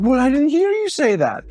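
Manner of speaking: very high mock protest; hammed-up high indignation; acted arguing wife. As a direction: fire back defensively in a high put-on voice. Voicing wife in retold argument.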